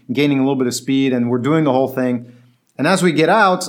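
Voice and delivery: usual speaking voice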